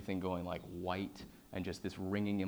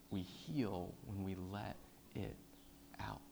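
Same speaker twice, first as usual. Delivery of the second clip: slow and soft; 9 dB quieter, slower than usual. Real, not acted. Slow repeated appeal.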